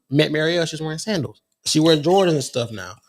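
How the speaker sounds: ordinary talk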